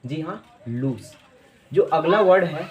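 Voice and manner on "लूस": "लूज" as loos, final s; correct pronunciation. Shown as a demonstration. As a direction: repeat "लूज" with s, not z. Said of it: "इसकी right pronunciation".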